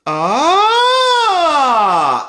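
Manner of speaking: loud, high, open sustained ah sliding down. Shown as a demonstration. Coach demonstrating to students an open ujjayi ah. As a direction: let the ah open up fully through the range. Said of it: "I can open it up".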